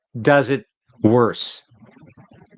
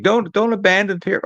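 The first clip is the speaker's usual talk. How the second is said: lightly voiced worried supporters, pitched higher; mock pleading voice; 8 semitones higher than usual. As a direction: mimic fans begging him not to quit. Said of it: "people saying".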